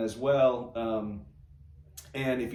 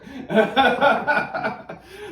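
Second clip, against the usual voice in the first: loud, high peal of laughter; high burst of laughter; 13 semitones higher, 10 dB louder than usual. Real, not acted. Host cracking up at the pun, loud high laugh. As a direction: let a big laugh burst out.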